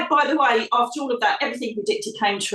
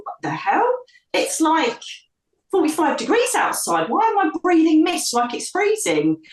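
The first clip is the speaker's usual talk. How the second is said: higher, astonished inner voice; baffled by breath misting in the heat; stunned what-the-hell, pitched up; retold disbelief; 7 semitones higher than usual.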